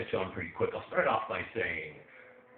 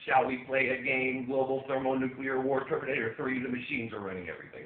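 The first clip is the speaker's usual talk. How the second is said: robotic monotone, raised pitch; freaky android voice; 4 semitones higher, 4 dB louder than usual. Acted. Comic mocking his phone's assistant, freaky android voice. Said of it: "that freaky Android voice".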